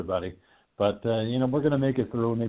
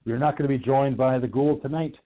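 ordinary talk, nothing marked

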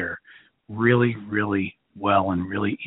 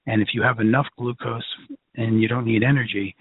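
ordinary delivery

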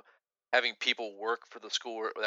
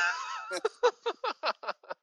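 high burst of laughter. Real, not acted. Laughing at own impression.

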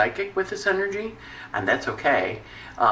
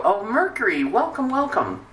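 effusive welcome; 8 semitones higher than usual. Acted. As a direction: stretch out a gushing welcome, pitched up. Acts out greeting Mercury.

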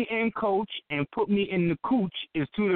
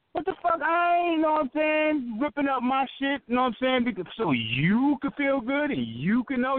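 voicing the woman; 9 semitones higher than usual.

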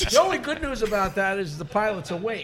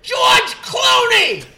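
slow, loud, high blurted yell; Fred's yell; 8 semitones higher, 12 dB louder, much slower than usual. Retold or imitated. Imitating Fred yelling on the show. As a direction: blurt it out like a tic. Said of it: "Fred would go".

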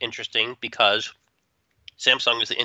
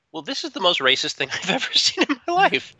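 high, incredulous mock outrage, laughing partway; half-laughing mock shock; 7 semitones higher than usual. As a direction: play up the disbelief, half joking. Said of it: "And I thought to myself".

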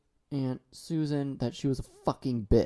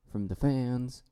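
ordinary delivery

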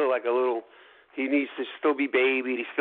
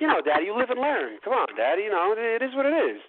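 put-on lightly raised childlike voice; imitating daughter; 6 semitones higher than usual. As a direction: voice the kid, a little higher. Daughter's line retold again.

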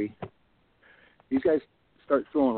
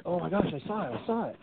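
rising higher and higher, but softer; breathless with alarm at what they just saw; excited alarm; 7 semitones higher, 5 dB quieter than usual. Retold or imitated. Friends spotting something in the bushes, higher excited alarm.

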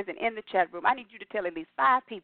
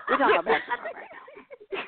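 high, sing-song teasing; mock-stern high tease; playful insistence. Real, not acted. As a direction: tease her back onto basketball. Teasing her co-host.

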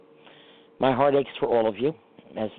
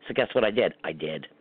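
ordinary talk

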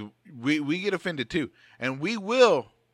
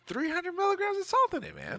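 shocked. Real, not acted. Friend outraged by salt in his snack, shocked high squeak. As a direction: pitch jumps way up in outrage.

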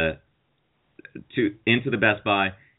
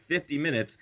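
usual speaking voice

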